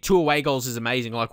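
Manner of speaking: ordinary talk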